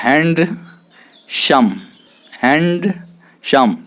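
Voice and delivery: हैंडसम said very slowly as two separate beats; syllable-by-syllable pronunciation. Shown as a demonstration. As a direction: split हैंडसम into "hand", long pause, "sum". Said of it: "देखेंगे उच्चारण कैसे होता है". (हैंडसम को दो खंडों में धीरे-धीरे तोड़कर बोलें)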